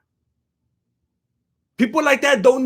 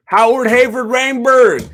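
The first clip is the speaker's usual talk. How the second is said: loud chat readout; 2 semitones higher, 7 dB louder than usual. Real, not acted. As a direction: announce the viewer's name and question loud. Rereading the chat question.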